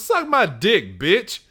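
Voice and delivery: high-pitched imitated comeback; mock rude retort. Noisy man to old woman in the cinema, mock rude retort. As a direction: play the obnoxious moviegoer answering back. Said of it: "he just goes".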